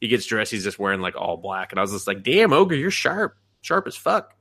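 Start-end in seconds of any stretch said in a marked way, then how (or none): 2.2-4.3 high-pitched admiring outburst, retold; impressed exclamation. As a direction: hype him up, voice pitched high.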